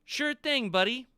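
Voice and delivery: high-pitched, loud little sidekick voice; Tony. Acted. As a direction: call out high and eager. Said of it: "Hey, Tony".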